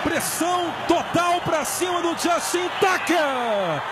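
usual speaking voice

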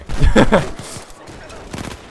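loud, high laugh. Real, not acted. Player mid-match, high burst of laughter. Laughs after a tense moment.

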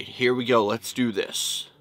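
usual speaking voice

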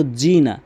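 জি না with a hard English j; jim for dhal. Shown as a demonstration. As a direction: say জি না quickly with j instead of dh. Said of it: "জিমের উচ্চারণ করে ফেলে". (যালের জায়গায় জিম দিয়ে জি না)

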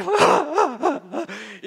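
a real burst of laughter, high. Real, not acted. Laughing at old church customs, real laugh. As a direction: laugh at the memory.

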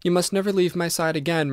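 put-on mother murmuring to her found child; murmured. A narrator's reading. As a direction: murmur it, holding her close. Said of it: "murmured Missus Otis".

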